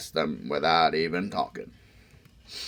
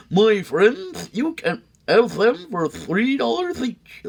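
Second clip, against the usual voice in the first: smooth salesman; 6 dB louder than usual. Acted. McBean pitching his fix to the sneetches, high salesman voice. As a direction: sell it like a fast-talking fix-it man.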